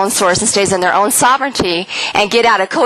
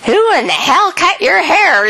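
higher, slow, incredulous scold; retold indignant outburst; 8 semitones higher, slower than usual. Woman waking from coma scolding husband about his haircut. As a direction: replay the shock at his hair, mildly hammed-up. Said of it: "I was pissed".

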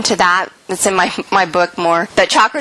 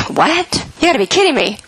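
higher, incredulous "What?"; stunned disbelief; 6 semitones higher than usual. Retold or imitated. Mother retelling her son's news with stunned disbelief. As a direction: replay the shock of hearing it.

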